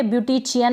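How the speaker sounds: "ब्यूटिचियन" with CH in chi-yan; wrong CH sound. Shown as a demonstration. Teacher warning against a misreading, "ब्यूटिचियन" with CH. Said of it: "ब्यूटिचियन नहीं पढ़ेंगे".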